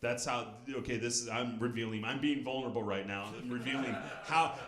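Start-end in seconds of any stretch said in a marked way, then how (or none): none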